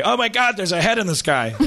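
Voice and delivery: high-pitched startled cry; mock astonishment. Driver sees a giant head overhead, high mock alarm. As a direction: spot something impossible and exclaim.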